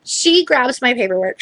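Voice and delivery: normal talking voice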